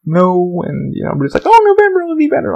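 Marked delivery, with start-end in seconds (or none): 1.4-2.6 very high, singled-out mimic voice; mocking optimism. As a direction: parody everybody's naive reassurance in high pitch. Mimics what everybody says.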